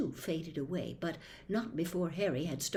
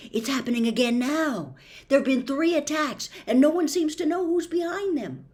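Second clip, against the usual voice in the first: much louder and higher, pressing; urgent excitement; 8 semitones higher, 8 dB louder than usual. Acted. Harry urgently tells the diary about attacks, loud. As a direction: rush it out, alarmed and eager.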